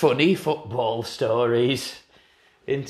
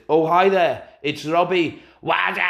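higher, louder, cheesy and upbeat, shouted ending; cheesy voicemail greeting; 6 semitones higher, 4 dB louder than usual. Retold or imitated. Robbie Savage's voicemail greeting, cheesy and shouty. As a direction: ham up a showy voicemail. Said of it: "greeted with".

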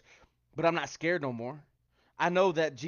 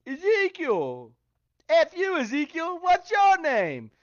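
much higher, louder, slow mock-angry quote; hammed-up viral rant, stretched out; TikTok impression; 12 semitones higher, 8 dB louder, slower than usual. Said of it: "I know y'all seen the TikTok".

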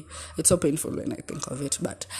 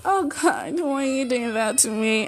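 put-on much higher, playful girly whine; cheeky voice; 8 semitones higher than usual. Shown as a demonstration. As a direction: squeak it out cheekily. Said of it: "that cheeky, cheeky voice".

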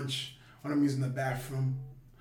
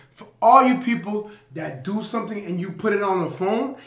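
hype speech; 4 semitones higher, 10 dB louder than usual. Acted. Loud hype advice to listeners.

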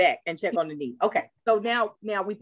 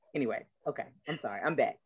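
usual speaking voice

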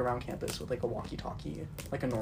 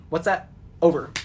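loud radio query into earpiece; police radio; 3 semitones higher, 7 dB louder than usual. Pretend. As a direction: answer your earpiece like a cop. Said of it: "push and be like".

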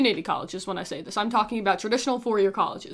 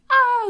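panicked scream; 12 semitones higher, 5 dB louder than usual. Retold or imitated. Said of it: "they're like".

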